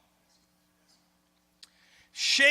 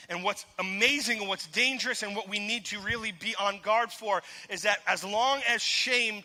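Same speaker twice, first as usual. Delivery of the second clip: ordinary talk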